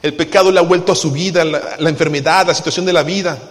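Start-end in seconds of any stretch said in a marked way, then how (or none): none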